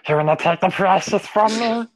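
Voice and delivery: high, whiny creature voice clutching its treasure; Gollum impression. The drunk friend guarding his vodka, high whiny Gollum voice. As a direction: hiss it like Gollum guarding the precious. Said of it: "my friend become Gollum".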